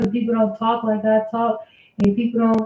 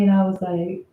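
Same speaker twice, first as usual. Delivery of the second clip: normal talking voice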